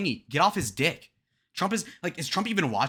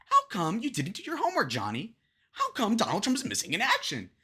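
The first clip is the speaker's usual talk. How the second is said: high-peaking mock teacher whine; whiny schoolteacher; 6 semitones higher than usual. Reactor likens candidate to teacher scolding Johnny, whiny high scold. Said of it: "this is whiny middle school teacher sort of shit".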